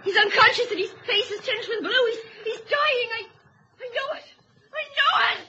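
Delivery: high, breaking despair. Acted. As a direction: lament the dying son. Mother sees son dying.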